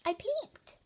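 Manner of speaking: high-pitched elephant voice; confessing elephant. A narrator's reading. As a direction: own up in a high voice.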